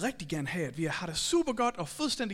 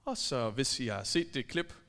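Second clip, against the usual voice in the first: usual speaking voice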